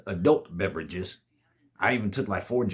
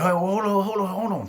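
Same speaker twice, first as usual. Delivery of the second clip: urgent call; 10 semitones higher, 4 dB louder than usual. Retold or imitated. Greg urgently stops his friend.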